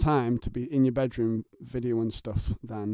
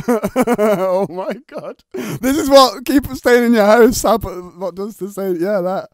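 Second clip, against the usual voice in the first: loud laughing shout; cracking up, barely getting words out; real howling laughter; laughing hard; 8 semitones higher, 9 dB louder, slower than usual. Real, not acted.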